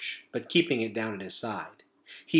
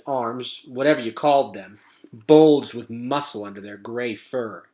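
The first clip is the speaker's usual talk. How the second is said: plain reading voice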